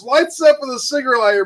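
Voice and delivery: ordinary talk, nothing marked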